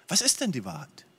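a softly despairing echo of the question; quiet bewildered repeat. Retold or imitated. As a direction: repeat it half to yourself. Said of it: "Und du denkst".

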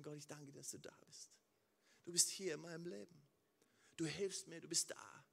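leise, langsame, innige Gebetsstimme; leises Gebet. Acted. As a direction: sprich das Gebet still und andächtig, mit Pausen. Gebetetes Dankgebet an Gott. (leises, andächtiges Gebet)

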